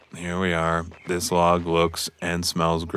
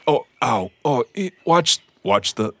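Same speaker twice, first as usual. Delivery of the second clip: sudden ow, higher and louder; pained yelp; 7 semitones higher, 5 dB louder than usual. Real, not acted. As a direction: yelp as something lands on you. Creature climbs onto him.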